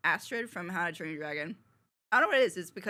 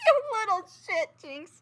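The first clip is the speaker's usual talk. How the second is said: laughing; 11 semitones higher, 7 dB louder than usual. Real, not acted. Laughing at Jinx joke.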